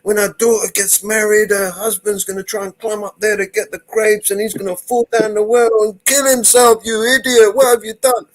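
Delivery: high, loud hammed-up scolding; wife raging at her husband, hammed-up and loud; mock scolding wife. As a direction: berate him like a hysterical wife. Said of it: "she goes".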